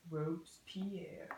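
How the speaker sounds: slow, hushed whisper of the name; dramatic whisper. Acted. Naming the dictator to classmates in a slow whisper. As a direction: breathe the name like a secret.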